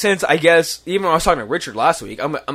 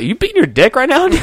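high laughing tease; 10 semitones higher, 4 dB louder than usual. Retold or imitated. Teasing friend, laughing.

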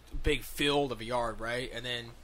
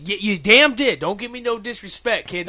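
put-on loud, higher furious old man scolding a kid; angry old neighbor; 8 semitones higher, 11 dB louder than usual. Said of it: "He's like".

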